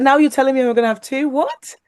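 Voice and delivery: high and fast, rising to a squeaky "What?"; incredulous. Retold or imitated. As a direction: act floored by the news. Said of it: "And I was like".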